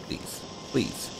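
hushed begging; whispered plea. Real, not acted. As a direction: beg under the breath. Hiding from monster, pleading.

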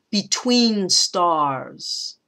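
"Between stars" very slow, both stressed; spondee. Shown as a demonstration. Teacher demonstrating a spondee to her class. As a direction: stretch out "stars" with full weight. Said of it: "I think that's a spondee too".

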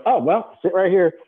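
high-pitched imitation of a trader; mock resigned welcome. Traders seating an unexpected new hire, imitated offhand order.

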